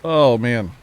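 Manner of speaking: said through a yawn; mid-yawn groan. Real, not acted. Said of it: "and I'm yawning".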